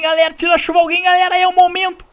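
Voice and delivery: high loud shout to the team; frantic rallying cry; excited yell. Real, not acted. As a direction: call everyone in now. Yelling to team in fight.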